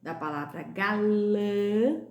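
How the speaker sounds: galã said slowly, nasal ã stretched; slow teacher demo of galã; nasal vowel demo. Shown as a demonstration. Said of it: "som nasal".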